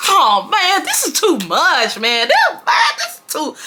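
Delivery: mock the overwhelmed boyfriend; high-pitched hammed-up groan of too much; exasperated whine. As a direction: voice jumps high, whining in dismay.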